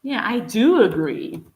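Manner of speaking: "do" hit hardest, falling at the end; firm emphatic "do"; stress on do. Shown as a demonstration. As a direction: lean into "do" in agreement. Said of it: "The do in this case adds emphasis".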